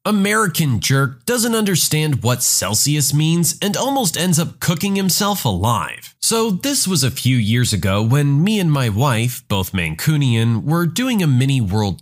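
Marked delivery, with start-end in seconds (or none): none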